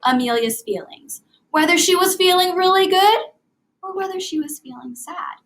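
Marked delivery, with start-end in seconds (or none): 1.5-3.2 higher, louder and happy; bright, rising delight on feeling good; excited. Shown as a demonstration. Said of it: "I tried to make my voice reflect". 3.8-5.4 softer, sad fall; gentle sad tone on feeling sad. Shown as a demonstration. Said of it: "I tried to make my voice reflect".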